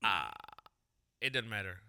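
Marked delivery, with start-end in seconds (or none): 0.0-0.3 low grunt. Retold or imitated. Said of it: "he does that before he speaks".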